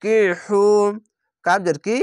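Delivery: ordinary talk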